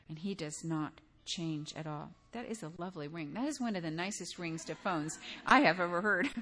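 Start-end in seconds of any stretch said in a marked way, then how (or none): none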